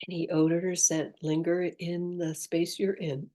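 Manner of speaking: soft, unhurried meditation voice with long pauses; guided meditation. Acted. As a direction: gently guide the listener inward, leaving space after each phrase.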